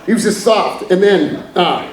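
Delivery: usual speaking voice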